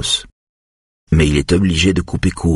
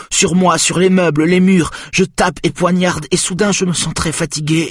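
put-on agitated boy; 9 semitones higher than usual. Boy under hypnosis reliving the stabbing, rising panic then exhaustion. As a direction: tell the nightmare as a frightened boy who can't stop.

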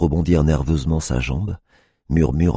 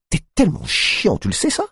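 very high, whiny complaint; exasperated. A narrator's reading. Tiffany fed up with the cop, high and whiny. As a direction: whine it, fed up.

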